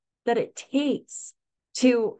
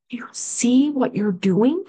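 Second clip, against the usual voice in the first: excited encouragement; 5 semitones higher, 5 dB louder than usual. Retold or imitated. Nurse cheering mom at bedside.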